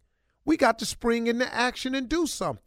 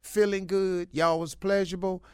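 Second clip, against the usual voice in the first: usual speaking voice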